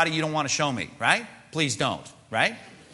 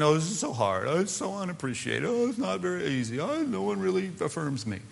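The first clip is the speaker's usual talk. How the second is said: put-on complainer's whine, climbing higher; whining; 5 semitones higher than usual. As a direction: moan on and on, self-pityingly. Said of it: "constant whining".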